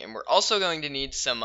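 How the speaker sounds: ordinary delivery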